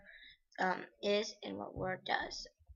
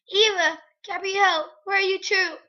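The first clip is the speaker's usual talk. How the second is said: calling out; 11 semitones higher, 15 dB louder than usual. Acted. Mother calling out for girls.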